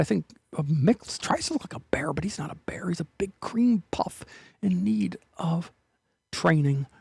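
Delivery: unvoiced teasing whisper. Whispering a teasing aside to viewers about the bear. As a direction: breathe it like a secret aside.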